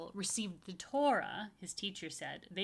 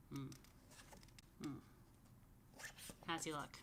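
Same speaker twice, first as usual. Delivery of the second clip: plain reading voice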